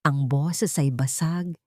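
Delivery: usual speaking voice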